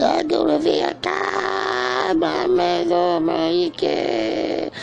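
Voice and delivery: very high, slow, stretched-out voice; high quoted reply. Retold or imitated. Answering who says he is Vietnamese, in a very high slow voice. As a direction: draw it out way up high. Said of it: "I say".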